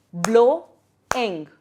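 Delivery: "Blow-ing" in two detached, loud beats; split into syllables. Shown as a demonstration. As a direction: break "Blow-ing" after BLOW with a pause. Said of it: "two syllables".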